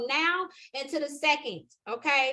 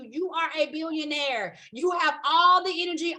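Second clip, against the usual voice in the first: normal talking voice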